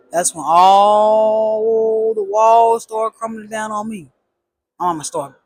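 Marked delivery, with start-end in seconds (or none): 0.1-4.1 dramatic slow. Real, not acted. Dramatic climax.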